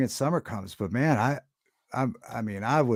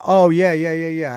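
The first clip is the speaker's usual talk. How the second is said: sudden recognition; 5 semitones higher, 8 dB louder than usual. Real, not acted. Suddenly remembers the answer.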